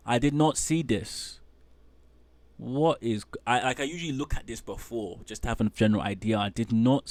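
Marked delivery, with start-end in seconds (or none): none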